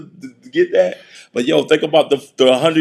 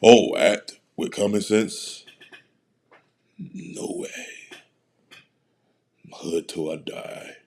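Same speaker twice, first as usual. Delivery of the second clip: mock low mutter; 8 semitones lower, 8 dB quieter, much slower than usual. Mock player voice.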